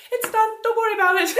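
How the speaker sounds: high carefree brush-off; breezy high voice. Retold or imitated. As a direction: squeak it out, all is fine. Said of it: "you just like".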